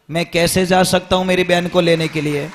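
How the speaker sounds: ordinary delivery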